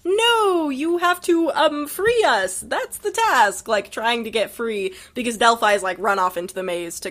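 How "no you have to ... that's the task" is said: exasperated Scorpius. Retold or imitated. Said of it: "And Scorpius is like".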